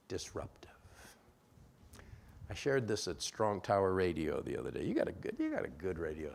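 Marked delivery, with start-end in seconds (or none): 0.1-0.7 breathy whisper, barely voiced. Shown as a demonstration. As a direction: whisper the word disruptive.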